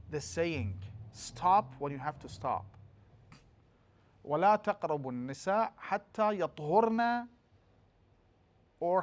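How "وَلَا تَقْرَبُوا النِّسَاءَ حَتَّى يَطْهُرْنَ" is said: yatthurna okunuşuyla tilavet; "يَطْهُرْنَ" with a plain short ha and no doubled consonant; Quran recitation. Shown as a demonstration. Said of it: "We have two reading".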